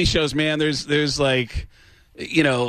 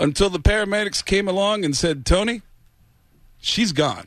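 [name] impression; 3 semitones higher than usual. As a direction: do a crooner reminiscing with little pauses. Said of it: "[name] impression".

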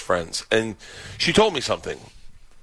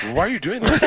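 higher-pitched, mock-aghast question; stunned disbelief; 8 semitones higher than usual. Betrayed friend questions the secret-teller, mock stunned. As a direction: imitate a friend frozen in disbelief. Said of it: "he was frozen".

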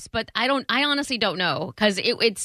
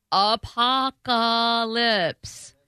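apocalypse said slowly syllable by syllable; slow careful; 3 semitones higher, much slower than usual. Shown as a demonstration. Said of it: "I can't read any of that".

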